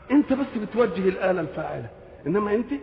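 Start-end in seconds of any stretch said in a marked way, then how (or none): none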